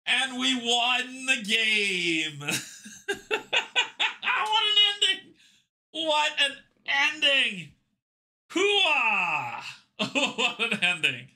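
high laughing shrieks of victory; shrieking jackpot winner. Real, not acted. Reacting to winning the game.

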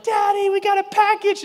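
put-on high, loud little-girl voice; excited little daughter. Daughter announcing a package, high and loud. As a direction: squeal it like an eager kid. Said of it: "She's like".

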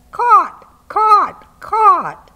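very high, loud cawing accusation; crow cawing. Acted. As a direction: caw each word like a crow. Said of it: "and he said".

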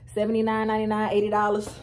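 normal talking voice

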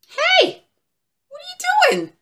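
very high, loud little turtle voice; high-pitched, scolding turtle; indignant turtle. Acted. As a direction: squeak it out, affronted and territorial. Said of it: "said the turtle".

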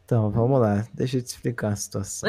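put-on deep serious boyfriend voice. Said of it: "para de falar com essa voz aí".

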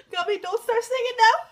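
loud, high-pitched plea; loud, overwhelmed protest way up high; pleading protest. Real, not acted. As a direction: cry out for the singer to hold off. Pleading with singer as sad song starts.

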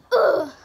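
loud fighting grunt; loud battle grunt; effortful grunt. Acted. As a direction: grunt hard as you strike back. Hero fights back.

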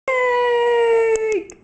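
shouted CAKE with high pitch; excited shout. Pretend. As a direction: yell it out happily.

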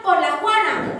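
angry mother screaming at Juan; furious shout. Acted. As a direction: bellow it at the foolish boy. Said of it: "y le gritó".

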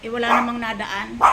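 normal talking voice

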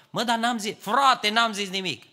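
mock protest. Aron voiced protesting innocence.

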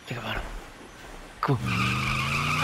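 tense whispered urging; hushed plea to the game. Real, not acted. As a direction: breathe it out under your breath. Tense moment in fight.

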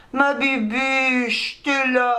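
very slow, drawn-out cooing; pet talk. Real, not acted. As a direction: stretch the pet name out lovingly. Parle tendrement à la chatte. (petit nom traîné tout doucement)

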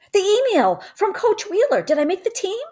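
can't wait to hear the answer; eager boy. Acted. Boy asking Mama if he made the team, excited and high.

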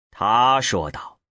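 usual speaking voice

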